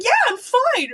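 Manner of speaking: high, bright cheerful squeak; innocent chirp. Retold or imitated. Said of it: "I'm like".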